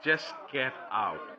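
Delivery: very slow, low order; cold angry dismissal. Acted. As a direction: draw each word out low to dismiss him. Priest dismisses Birsa with restrained anger.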